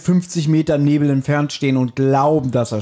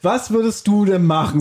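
usual speaking voice

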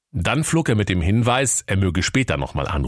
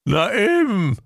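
very high, louder agreeing voice; eager octopus; 13 semitones higher, 3 dB louder, slower than usual. A narrator's reading. Octopus agreeing with the parrot, high and eager. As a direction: chime in high and keen.

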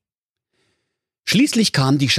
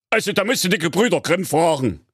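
gebellt; 8 semitones higher, faster than usual. Acted. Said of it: "bellte König Klaus Klops".

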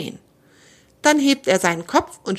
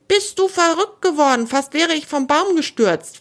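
hissing at him; 8 semitones higher than usual. A narrator's reading. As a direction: scold the bird who nearly made you fall. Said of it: "faucht das Eichhörnchen". (empört anfauchend, höher und lauter)

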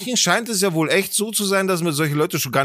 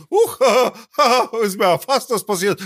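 acted relief with a nervous haha; laughing off a fright; 5 semitones higher than usual. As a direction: play a near miss as a joke. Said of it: "so ein bisschen in die Richtung".

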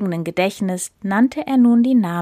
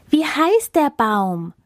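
eager repeat; 6 semitones higher, slower than usual. Acted. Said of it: "wiederholten die hungrigen Tiere".

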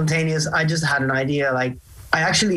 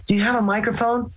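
eager ask; 7 semitones higher than usual. Retold or imitated. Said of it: "she was like".

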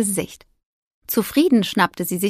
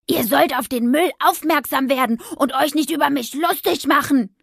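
higher, raised voice down the street; angry bellow; 6 semitones higher than usual. Acted. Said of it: "brüllte sie die Straße entlang". (wütend die Straße entlang brüllen)

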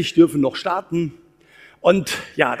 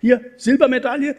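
put-on proud boy; 7 semitones higher, 4 dB louder than usual. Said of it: "kommen und sagen".

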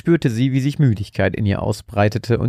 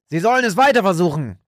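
calling back; 9 semitones higher than usual. A narrator's reading. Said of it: "rief ihr Frank zu".

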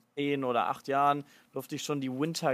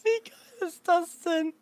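excited; 4 dB louder, slower than usual. Real, not acted. Amazed reaction to game story.

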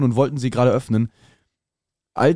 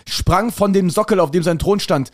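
ordinary talk, nothing marked

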